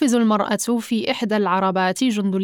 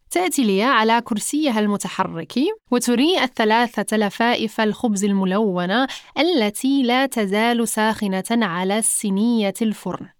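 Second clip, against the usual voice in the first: ordinary delivery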